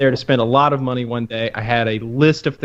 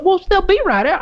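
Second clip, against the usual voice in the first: much higher, sing-song clerk reassurance; mock clerk voice. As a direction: chirp it like a dismissive clerk. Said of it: "she's like".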